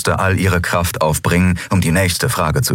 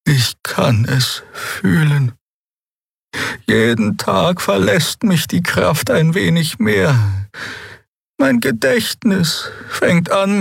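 put-on old healer, sad; 13 semitones higher than usual. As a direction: tell her decline gently. Xea describes fading.

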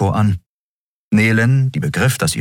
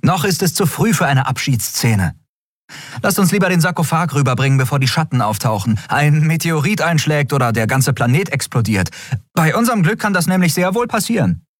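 high, hurried, bossy voice; fast, high-pitched impatient urging; Endriel, impatient; 10 semitones higher than usual. Acted. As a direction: rush them along in a high voice.

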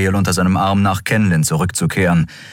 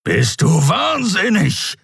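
slow, raised angry growl; 12 semitones higher, slower than usual. A narrator's reading. The Skrier snaps at the struggling woman, growl. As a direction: growl it in indignant disbelief. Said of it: "knurrte der Skrier".

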